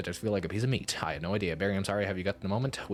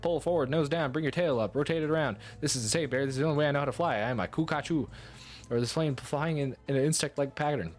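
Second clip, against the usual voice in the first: higher-pitched, keyed-up directing; urgent commands; 6 semitones higher than usual. Acted. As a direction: talk the pilot down urgently, pitch staying high. Frantic landing instructions to pilot.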